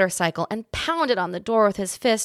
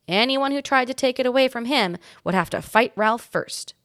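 usual speaking voice

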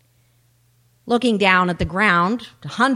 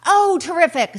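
high, loud sheep's exclamation; relieved sheep; 9 semitones higher, 7 dB louder than usual. Acted. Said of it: "said the sheep".

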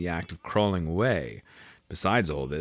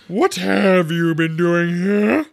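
high, loud, drawn-out interrogation; angry demand; 12 semitones higher, 9 dB louder, slower than usual. A narrator's reading. Queen questions the gardeners, angrily.

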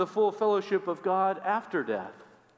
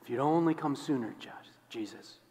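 soft, fading to a breaking whisper; broken plea; 2 semitones lower, 5 dB quieter than usual. Acted. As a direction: let the name die in a whisper. Grieving plea fades to whisper.

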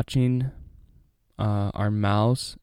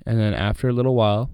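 usual speaking voice

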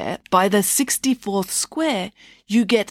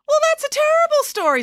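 much higher, loud and appalled; indignant protest; 3 dB louder, faster than usual. Real, not acted. Daughter scolding Mum's story, high and outraged. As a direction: throw the story back at her, outraged. Vanessa protests the gruesome ending.

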